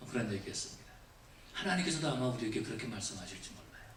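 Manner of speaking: normal talking voice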